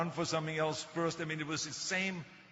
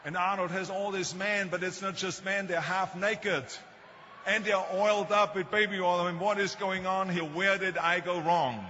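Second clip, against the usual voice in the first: rising alarm, louder and higher, like a fretting mother; upset mother; 4 semitones higher, 6 dB louder than usual. Retold or imitated. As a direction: climb in pitch and volume as a worried mother. Mother upset about son's posters.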